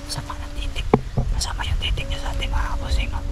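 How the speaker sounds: secretive whisper to the viewers; uneasy whispered confiding; hushed aside. Real, not acted. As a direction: whisper it, barely audible.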